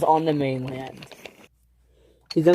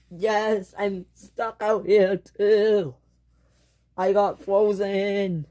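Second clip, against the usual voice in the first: slow, high, plaintive; drawn-out pitiful voice; forlorn stranded engine; 5 semitones higher, much slower than usual. Acted. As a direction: complain helplessly, stretching the words. Stranded frozen engine answers.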